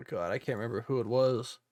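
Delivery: ordinary talk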